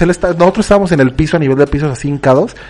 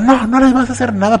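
mom defending; 7 semitones higher than usual. Retold or imitated. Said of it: "Entonces empezó".

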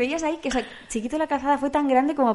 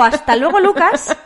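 Chiquito catchphrase voice; 5 semitones higher, 8 dB louder, slower than usual. Retold or imitated. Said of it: "porque si le digo".

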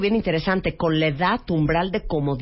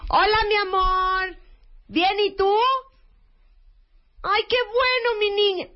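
shouting on the phone; 13 semitones higher than usual. Retold or imitated. Mom greeting her daughter over the phone, shouting. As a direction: yell into the phone like you can't hear. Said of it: "¿Por qué gritamos?".

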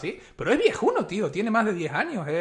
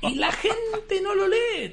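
much higher, laughing through the words; laughing disbelief; much slower than usual. Real, not acted. Mocking people who copy code unread, laughing. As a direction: squeal it out, cracking up.

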